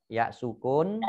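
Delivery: ordinary talk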